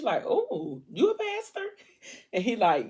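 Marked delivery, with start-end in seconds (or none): 0.3-1.7 very high, playful flirty squeal; joking flirtation. Retold or imitated. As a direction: act pleasantly scandalized, voice shooting up high. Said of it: "Arya's way of trying to ease the situation".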